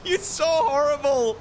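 loud, high-pitched scolding wail; playful outrage. Pretend. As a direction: scold a friend in mock horror.

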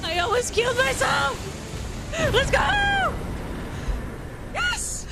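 high, fast triumphant cheering; excited cheer. Real, not acted. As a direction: celebrate the close call. Close call victory.

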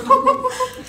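short squeaky laugh; high giggle. Real, not acted. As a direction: let a giggle escape. Bursts out laughing.

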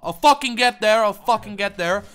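ordinary talk

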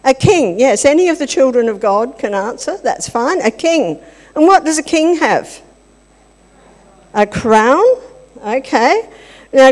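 ordinary talk, nothing marked

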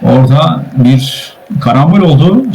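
ordinary delivery

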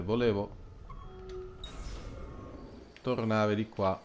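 normal talking voice

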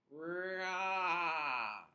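a grating, door-like bleat; creaky bleat. Acted. For the class, the sheep's failed creaky baa. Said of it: "she sounded like the barn door on a rainy day".

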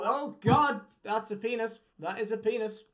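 ordinary talk, nothing marked

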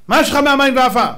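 high, loud incredulous question; acted challenge. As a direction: demand it in mock disbelief. Voices challenge to Moses.

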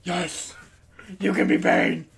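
rasping, solemn superhero voice; Batman. Acted. Hero lets friend play Bane, Batman rasp. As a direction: grant it like a raspy caped hero. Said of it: "Thank you, Batman".

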